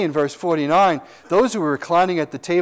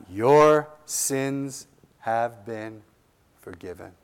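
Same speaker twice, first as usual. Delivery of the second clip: reassuring Jesus; 5 semitones lower, slower than usual. Acted. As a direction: assure her tenderly, fading to a hush on forgiven. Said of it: "He's assuring her".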